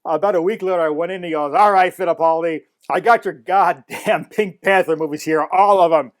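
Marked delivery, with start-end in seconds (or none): 1.5-6.1 loud, higher, slowed fed-up announcement; imitated exasperation. Needled shop owner handing over the movies, mock exasperated. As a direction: give in grudgingly at full volume, as the owner. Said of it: "just to piss him off".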